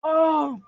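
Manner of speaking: anguished wail. Real, not acted. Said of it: "I was so sad".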